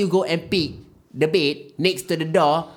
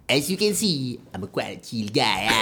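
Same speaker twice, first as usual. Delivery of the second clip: put-on Malaysian accent; 7 semitones lower, 4 dB quieter than usual. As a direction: play a chill Malaysian guy. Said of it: "practicing this, uh, accent".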